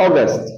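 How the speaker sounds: অগাস্ট with the last part cut very short; clipped August ending. Shown as a demonstration. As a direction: snap off the end of অগাস্ট. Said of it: "একদম ছোট হয়ে যাবে".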